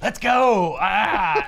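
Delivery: excited monster cheering "Let's go"; Lizard's gleeful shout. Retold or imitated. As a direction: yell it like he's thrilled to ride along. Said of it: "the Lizard being like".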